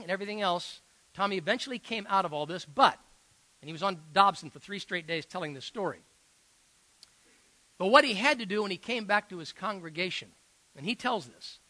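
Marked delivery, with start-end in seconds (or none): none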